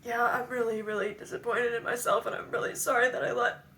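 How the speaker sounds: high voice breaking into crying; apologise, rising into real sobs; tearful apology. Real, not acted. Sorry for letting everybody down, in tears.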